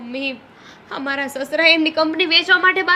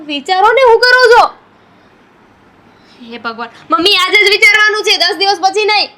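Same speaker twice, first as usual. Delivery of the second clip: exasperated shouting; 3 semitones higher, 8 dB louder than usual. Acted. Daughter loudly scolds her mother to come up with an idea, exasperated. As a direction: snap at mother to think now.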